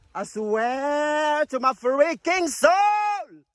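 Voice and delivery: slow swelling exclamation with long vowels; exaggerated drawl. Real, not acted. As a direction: stretch every word out long. Exaggerated swearing at the view.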